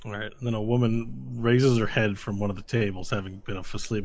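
usual speaking voice

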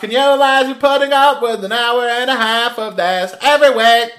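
put-on loud joking Cockney accent; mock Cockney. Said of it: "it is Cockney".

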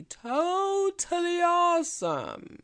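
high, loud, drawn-out hype on TOTALLY AWESOME; over-the-top excitement. Real, not acted. Promoting tomorrow's free group mentorship, exaggerated excitement. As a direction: stretch "totally awesome" way up high with playful gusto.

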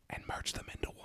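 dramatic hushed whisper. Acted. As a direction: drop to a secret whisper. Dramatic reveal to cohost.

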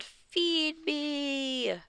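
hammed-up zombie demand; monster's yell. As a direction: growl for food like a graveyard ghoul.

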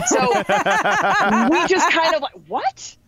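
stunned and cracking up; shocked laughter; laughing disbelief. Retold or imitated. Babysitter revelation.

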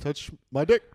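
halting lead-in, then punch it out; Obama doing Andrew Dice Clay. Pretend. Obama starts doing a crude comic's act, punchy impression. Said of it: "Obama starts doing fucking, uh, Andrew Dice Clay".

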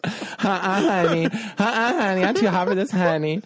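high sassy diva voice; camp, high-pitched "honey" voice. Acted.

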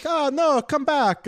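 high, loud mock plea; hammed-up begging them to return; pleading listeners. Pretend plea to departing Italian listeners, loud and high.

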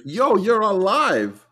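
high, slow drawn-out astonishment; stretched-out, high-pitched disbelief; mock amazement. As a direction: act stunned to hear from someone long lost. Acts the barber's surprised reply.

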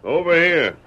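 loud, high firm order; commanding shout. Acted. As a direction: bark it so he obeys at once. Ordering stranger closer.

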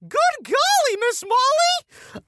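very high-pitched, drawn-out mock scream; screaming teacher. Teacher bursting in on two kids on the couch, mock scream. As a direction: screech it high, stretching the last word. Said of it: "from over your shoulder".

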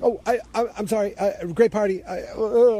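high, stammering mock embarrassment; flustered apology. Harry caught by Megan, stammers apology.

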